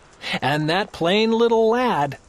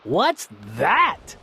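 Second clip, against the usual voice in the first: snorted; 7 semitones lower than usual. Acted. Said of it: "snorted Yertle".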